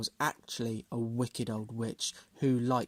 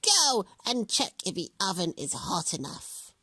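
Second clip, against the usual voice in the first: high hag voice; witch voice; 7 semitones higher than usual. A narrator's reading. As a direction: order her like a witch. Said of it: "the witch".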